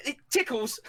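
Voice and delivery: high, twitchy yelp; acted ticklish outburst; squirming discomfort. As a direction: squeal it while wriggling uncomfortably. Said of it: "as if she's, like, really uncomfortable".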